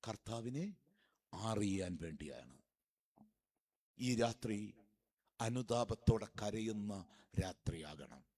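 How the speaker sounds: low, slow solemn appeal; hushed, measured sermon voice; solemn preaching. Real, not acted. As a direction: draw the congregation in, low and unhurried.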